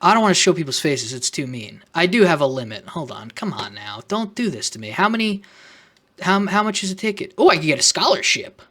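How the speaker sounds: ordinary talk, nothing marked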